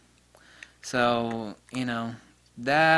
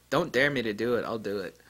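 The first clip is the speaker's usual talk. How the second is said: normal talking voice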